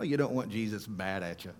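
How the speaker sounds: ordinary talk, nothing marked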